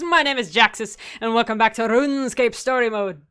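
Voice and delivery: hammed-up Scottish intro; Scottish-leaning accent. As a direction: announce it with a Scottish lilt. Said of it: "That was more Scottish".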